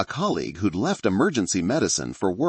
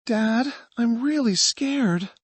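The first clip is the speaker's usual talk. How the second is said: very high, slow, small frightened voice; scared daughter; slower than usual. A narrator's reading. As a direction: plead like a frightened child. Frightened daughter pleading with her father.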